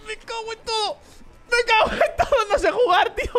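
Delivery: high squealed curse breaking into laughter; laughing cursing. Real, not acted. Gamer curses own bad driving, squealing with laughter.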